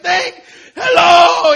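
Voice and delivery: elated high shout, drawn out; overjoyed George Bailey. Retold or imitated. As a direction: holler a greeting in hammed-up joy. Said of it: "he gets so excited".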